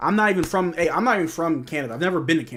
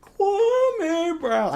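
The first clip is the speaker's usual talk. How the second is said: Kwame Brown again, very high and stretched, held Kwame; Stephen A impression; 6 dB louder than usual. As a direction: echo the pundit's rant at full pitch. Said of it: "sounds like Stephen A talking about".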